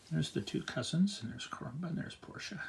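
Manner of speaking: soft half-whispered pointing; hushed aside. Real, not acted. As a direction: point out the figures quietly. Pointing at pictures to child.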